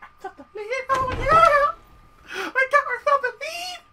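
high squealed cheer, half laughing; squealing glee. Real, not acted. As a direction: shriek it out with giddy joy. Squealing celebration of new lead.

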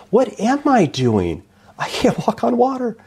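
suddenly unsure, laughing at yourself; doubting Peter. Retold or imitated. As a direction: Peter losing his nerve on the water. Peter doubts himself mid-walk.

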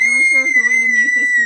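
shouting over the noise; yelling to be heard. Real, not acted. Kids screaming over loud noise.

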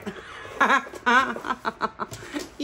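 high staccato laugh; giggling fit. Real, not acted. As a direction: burst into helpless giggles. Laughs at her own hint.

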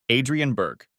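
ordinary delivery, nothing marked

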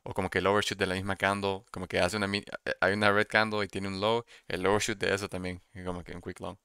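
Spanish with English trading words; casual Spanish mixed with English terms; Spanglish. Real, not acted. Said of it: "Sorry for the Spanglish".

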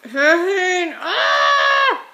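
high loud scream of pain; pained shout. Real, not acted. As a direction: shout through the pinch. Clothespin pinches face.

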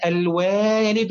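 "الوالد" with a very long waa; stretched long vowel. Shown as a demonstration. Teacher demonstrates madd to pupil, long waa in "الوالد". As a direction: hold the alif after the waw in "الوالد". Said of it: "ففيها مد بالألف".

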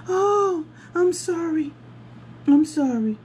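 high whiny mock apology; hammed-up sorry, almost crying. Worker apologizing, voiced by teller.

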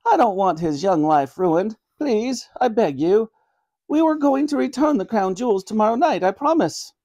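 high-pitched, earnest granny voice; pleading granny. Acted. Granny begging mercy for her grandson, pleading. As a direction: beg as an old grandmother.

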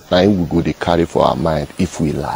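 ordinary talk, nothing marked

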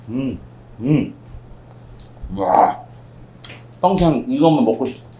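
talking while chewing; words muffled by food; mouth full. Real, not acted. Seen 0: cheeks bulge, lips pursed, chewing after bite throughout words.

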